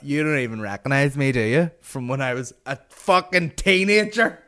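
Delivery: building to a high, loud rant; mock outrage. Comic mock-berating the big shot, building outrage.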